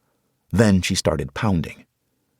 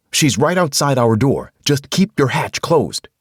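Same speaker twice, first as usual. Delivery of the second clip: shouted warning; 8 semitones higher, 5 dB louder than usual. Acted. Man shouting to Carol to keep the hatch shut. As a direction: drive the warning home.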